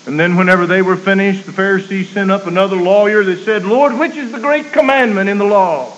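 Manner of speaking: high, loud preacher's cadence; preaching at full force. Acted. Preacher telling the congregation of the Pharisees, full-force preaching. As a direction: ring it out to the congregation. Preacher recounting lawyer's question.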